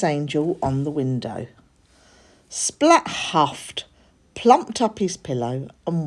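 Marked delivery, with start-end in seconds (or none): none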